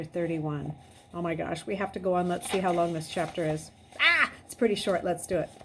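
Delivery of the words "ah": ordinary delivery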